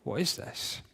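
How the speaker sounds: unvoiced, scoffing mutter of a bored teen. Teen stuck at the convention, scoffing under breath. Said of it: "you're sat here going".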